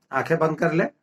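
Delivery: spaced-out, calm instructions for breathing and visualising; guided meditation. Real, not acted. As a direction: lead them gently inward, phrase by phrase, unhurried.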